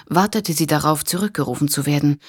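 ordinary delivery

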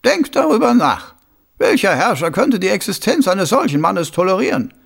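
a scheming noble, savouring his idea; sly, high-pitched lordly voice; scheming Lord Schnappüber. Acted.

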